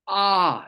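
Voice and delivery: "ah" loud, high and held; open ah sound. Shown as a demonstration. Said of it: "put the ah into it".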